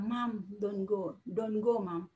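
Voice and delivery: slow, softly imitated little boy pleading; child's soft plea. Small son tugging mother's skirt, slow soft imitated plea. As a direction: plead quietly and slowly, lightly voicing a child.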